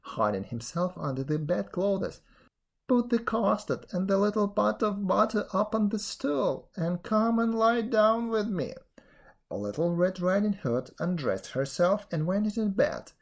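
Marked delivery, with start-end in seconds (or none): 2.9-8.7 wolf posing as granny, higher even voice; disguised wolf. A narrator's reading. Wolf in bed inviting girl, raised disguised voice. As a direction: coax in a raised, false granny voice. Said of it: "said to her".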